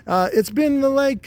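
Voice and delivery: fumbling for the number in a high voice; talking testicle. Acted. A high-voiced testicle counting the time since last release. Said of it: "And he's like".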